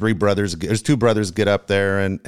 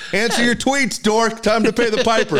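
much higher, louder mock voice, teasing; mocking taunt; 14 semitones higher, 3 dB louder than usual. Host mimics the cohost taunting him online, high mocking voice. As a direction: squeak it out like a jeering heckler. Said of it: "you just gonna say".

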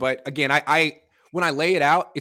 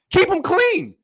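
loud, pitched-up insistence; emphatic; 5 dB louder, slower than usual. Real, not acted. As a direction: drive the point home. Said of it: "All right, sorry".

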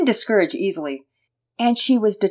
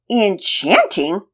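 puzzled echo; 3 semitones higher, 5 dB louder, much slower than usual. Acted.

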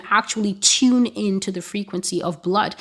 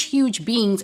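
usual speaking voice

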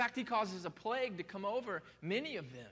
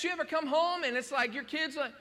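normal talking voice

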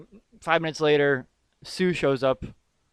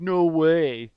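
mock disbelieving "no way", louder; first-try movie quote; 3 semitones higher, 7 dB louder than usual. Podcaster quoting the movie hero's reaction, mock disbelief. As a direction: exclaim it as a rough movie quote. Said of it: "he'd be more like".

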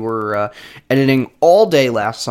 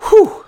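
high relieved whew; 9 dB louder than usual. Real, not acted. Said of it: "breath after hiccups".

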